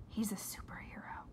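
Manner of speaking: hushed whisper. A narrator's reading. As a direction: whisper the secret. Whispered explanation.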